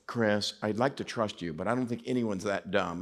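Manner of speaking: low, soft skeptical voice; quiet, low-pitched doubter; doubtful confiding. Retold or imitated. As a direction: gently break your disbelief to a friend, voice dropped. Acting out skeptical church people's reply.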